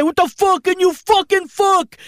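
high, slowed furious cursing; mock road-rage outburst; angry yelling cabbie. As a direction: spit it out like an enraged driver. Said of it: "and then he said".

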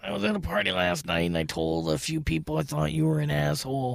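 lightly voiced hungover caller, muted; confessing at a party, mildly gruff and quiet; Dan Dunn voice. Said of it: "He's a really rough voice".

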